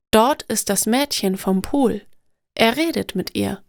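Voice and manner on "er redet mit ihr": ordinary delivery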